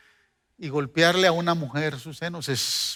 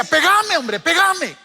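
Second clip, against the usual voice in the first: begging shout; 11 semitones higher, 6 dB louder, slower than usual. Retold or imitated. Begs to be hit.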